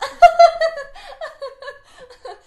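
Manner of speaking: loud, high fake laugh; put-on laugh. Shown as a demonstration. Showing how she laughs for a photo, loud fake laughter. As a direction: burst into forced laughter as if on cue. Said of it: "I'll show you exactly what happens".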